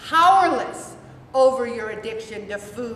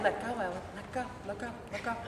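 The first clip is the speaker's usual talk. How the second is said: inner voice; 4 semitones lower, 10 dB quieter, much faster than usual. Retold or imitated. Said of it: "I was saying it in my head".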